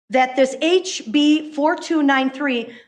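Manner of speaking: normal talking voice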